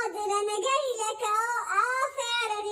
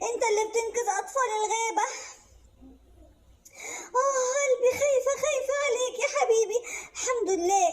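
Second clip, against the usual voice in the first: a mother half sobbing with relief; tearful relieved mother; 6 semitones higher than usual. Acted. Mother relieved rabbit is back.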